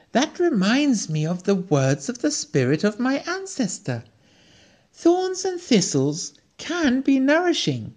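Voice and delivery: high, excited reveal of Thorns and thistles; light up on Thorns and thistles, the answer at last; eager recollection. A narrator's reading. Recalls ancestor's words eagerly.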